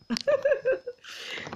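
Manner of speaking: short, high burst of laughter; a real high cackle. Real, not acted. Laughing at a viewer's name with a high cackle.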